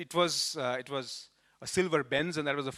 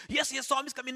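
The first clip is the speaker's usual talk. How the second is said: higher, eager reassurance; insisting to the doubting boy, higher and eager; 9 semitones higher, faster than usual. Retold or imitated. Yes yes, pitched up and keen.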